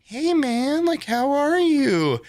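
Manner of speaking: high, drawn-out mock greeting; overfriendly stranger. Imitates overfriendly stranger approaching him.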